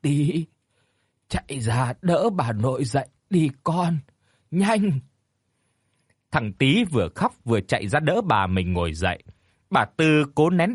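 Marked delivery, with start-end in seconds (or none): 0.0-4.9 weak, laboured urging; out of breath. A narrator's reading. Dying grandfather urges the boy, breathless and halting. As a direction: push words out between short breaths. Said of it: "Ông thở hổn hển".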